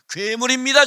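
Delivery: loud, high 괴물입니다 with a rising end; loud declaration. Shown as a demonstration. Preacher contrasts two deliveries.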